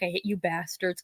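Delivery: grumbling brother. Retold or imitated. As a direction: mutter it, fondly annoyed. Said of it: "He's like".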